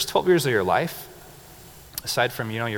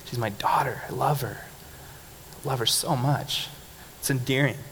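usual speaking voice

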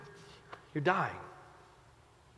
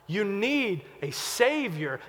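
slow earnest; 2 semitones higher, slower than usual. Real, not acted. Preacher's slow, hushed point.

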